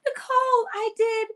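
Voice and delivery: bubbly, proud rush; excited self. Retold or imitated. Retelling her own excited boast.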